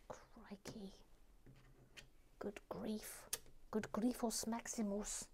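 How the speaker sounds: very soft, whispery grumble; hushed self-reproach; muttered dismay. Real, not acted. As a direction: mutter it under your breath. Muttering at own mistakes.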